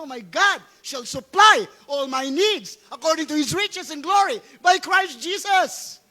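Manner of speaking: high, loud preaching shout; forceful declaration. Shown as a demonstration. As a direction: fire the verse back defiantly. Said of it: "Dapat sasagot mo".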